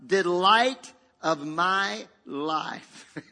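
very slow, emphatic savouring; voice of the Father. Acted. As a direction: stretch each word out with weight. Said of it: "Along with Spirit says".

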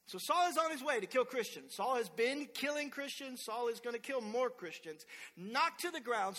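ordinary delivery

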